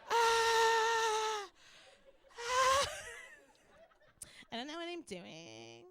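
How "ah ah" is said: long, loud high wail; high held cry. Acted. Comic saying she will run, high held cry. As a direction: hold a high-pitched aah, then repeat shorter.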